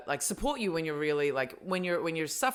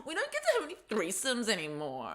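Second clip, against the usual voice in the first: high mocking whine; whiny voice; 5 semitones higher, 3 dB quieter than usual. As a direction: whine it like a sulky kid. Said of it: "in this whiny voice".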